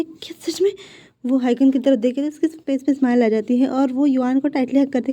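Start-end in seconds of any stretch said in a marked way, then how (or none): 0.0-0.7 higher amazed question; can it really be true; husband can't believe the good news, higher. Acted. Husband hears pregnancy confirmed.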